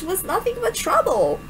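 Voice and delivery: normal talking voice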